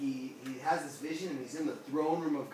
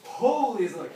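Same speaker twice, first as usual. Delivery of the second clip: loud, higher-pitched proclamation; angels crying out; 7 semitones higher, 10 dB louder than usual. Acted. Angels crying out before God's throne, loud proclamation. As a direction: cry it out to shake the walls. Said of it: "as they're crying out".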